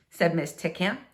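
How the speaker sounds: plain reading voice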